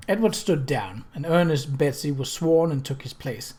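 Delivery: plain reading voice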